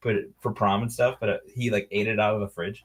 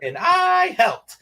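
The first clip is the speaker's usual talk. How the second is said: put-on high, loud kid voice with a long stretched I; hammed-up child chiming in proudly; proud movie kid; 6 dB louder, much slower than usual. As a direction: imitate a kid boasting, high and drawn out. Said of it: "and then the kid goes".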